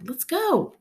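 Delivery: put-on high, quick child's urging; little brother leading the way; eager little boy. A narrator's reading. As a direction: tug her along like a four-year-old. Little boy pulling sister.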